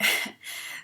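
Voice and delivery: short breathy laugh. Real, not acted. Laughs after own joke.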